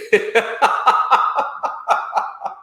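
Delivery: high, breathless laughter; real long, trailing laugh; laughing fit. Real, not acted. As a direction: crack up at the punchline. Laughing again at the quoted reply.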